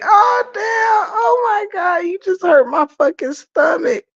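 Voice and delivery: laughing scream. Real, not acted. Cracking up at a joke.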